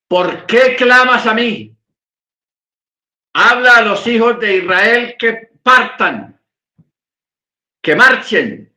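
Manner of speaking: high, slow, emphatic divine order; commanding rebuke. Acted. God ordering Moche to get Israel marching, commanding. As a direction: proclaim it as a firm command from above.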